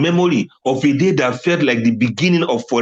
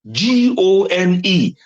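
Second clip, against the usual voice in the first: G O N E spelled slowly on a high pitch; spelled-out emphasis; 6 semitones higher, slower than usual. Real, not acted. Driving home that the old self is gone, spelled emphatically. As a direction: draw out each letter of G O N E, high.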